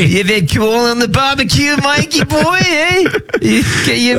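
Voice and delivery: high, slow hammed-up Australian accent. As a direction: lay the Aussie on thick. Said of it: "in Australian accents".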